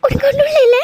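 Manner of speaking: high, loud tearful questions; crying anxiously down the line; wailing. Acted. Girl asking her fiancé on the phone if he is safe, wailing. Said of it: "நீ அலறது எனக்கு இங்க வரைக்கும் கேக்குது".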